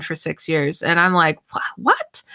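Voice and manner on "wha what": baffled. Retold or imitated. Reacting to friend's strange call.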